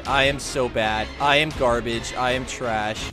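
low, muted, deflated delivery; low-pitched, defeated litany; dejected self-blame. Real, not acted. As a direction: sink into glum self-reproach. Berates self after failing.

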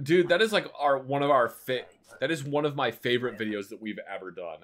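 ordinary talk, nothing marked

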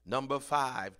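ordinary talk, nothing marked

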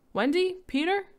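high, loud questioning call. Acted. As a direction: call into the empty rooms. Parents searching for children.

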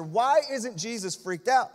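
normal talking voice

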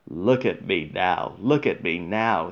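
a hint of a boastful puffing engine. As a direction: chuff the words out like a proud engine. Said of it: "he puffed".

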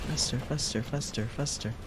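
being chased. Real, not acted. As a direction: urge her on, then trail off. Urging her character to flee.

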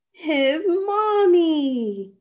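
high, loud, very slow cry; delighted reveal. A narrator's reading. Bear running to his mother, delighted. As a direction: stretch it out with joyful emotion.

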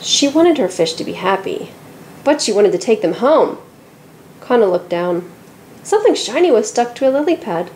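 usual speaking voice

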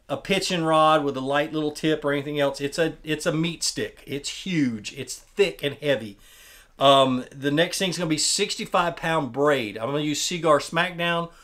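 ordinary delivery

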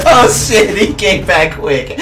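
yelp, then dissolve into laughing; startled and cracking up; laughing alarm. Real, not acted. Opponent returns again.

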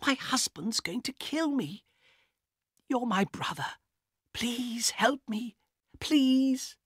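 desperate pleading. Acted. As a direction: plead for help, breaking. Elena begs her brother.

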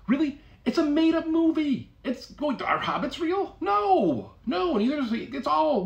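high-pitched scoffing disbelief; mock incredulity. Comic debunking the Lord of the Rings claim, mock incredulous.

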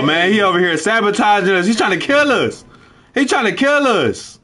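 alarmed he is sabotaging them; agitated. Real, not acted. Accusing saboteur.

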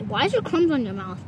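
ordinary talk, nothing marked

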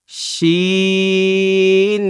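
শিন stretched very long, steady pitch; slow, held শিন for a class; drawn-out shin. Shown as a demonstration. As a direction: hold শিন long like a chant. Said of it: "বলেন".

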